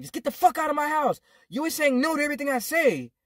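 retold stepdad's high-pitched angry rant; angry outburst. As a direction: throw him out, pitch jumping up.

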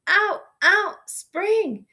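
high, loud happy shout. A narrator's reading. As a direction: shout it out like a toddler. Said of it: "shouted to her brother".

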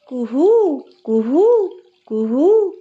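high, sing-song imitation of a cuckoo; cuckoo call. A narrator's reading. Narrator imitating the spring cuckoo in the bakul tree, high birdlike coo. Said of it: "বসন্তের কোকিল ডাকিল".

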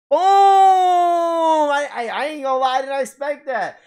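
loud, high burst of joy at a hit; excited yell. Real, not acted. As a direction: shout it out, thrilled by the pull. Pack hit, excited yelling.

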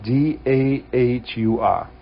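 slow New England accent, A spelled as R; letters spelled with a New England intrusive r. Real, not acted. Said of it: "my New England accent is, uh, is coming out".

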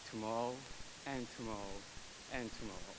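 hushed, dramatic Shakespeare recital; Macbeth soliloquy. Acted. Poet reciting Macbeth from theater club, hushed and dramatic. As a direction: intone it softly like a brooding actor. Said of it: "memorized the soliloquy of Macbeth".

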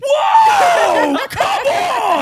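high loud whoop of delight; excited shout. Real, not acted. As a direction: cheer it like a winning goal. Cheering the finished story.

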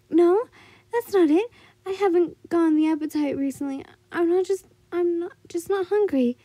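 loud, high flustered denial; defensive girl voice. Acted. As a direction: protest too quickly, flustered. Mitsuri denies she's starving.

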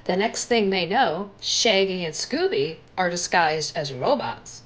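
ordinary delivery, nothing marked

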